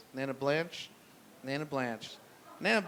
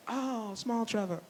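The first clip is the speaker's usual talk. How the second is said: put-on frail old lady; 6 semitones higher, 5 dB quieter than usual. Nana on deathbed, voiced.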